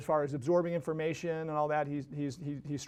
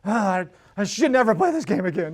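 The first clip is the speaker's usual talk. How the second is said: frustrated son; 7 dB louder than usual.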